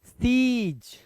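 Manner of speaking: सीज with a held, high "ee"; long vowel. Shown as a demonstration. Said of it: "ये ई है".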